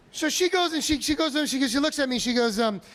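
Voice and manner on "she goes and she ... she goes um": normal talking voice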